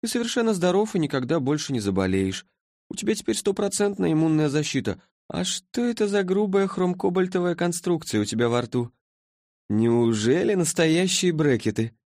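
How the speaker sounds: sleepy being from the future, puzzled; languid creature. A narrator's reading. Creature's voice in story.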